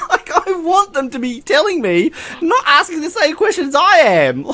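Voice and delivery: high, giggling complaint; laughing rant. Real, not acted. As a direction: let laughter break through. Laughing while ranting.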